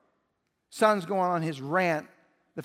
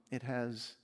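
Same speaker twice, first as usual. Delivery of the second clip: soft, low, tender father's answer; father lovingly answering his jealous son; 5 semitones lower, 7 dB quieter than usual. Acted. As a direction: gently reassure your hurt son. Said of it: "the father could lovingly respond".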